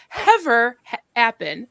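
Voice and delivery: "Hever" with an added H, "happen" with it dropped; misplaced H's. Retold or imitated. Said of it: "she wouldn't say the H until".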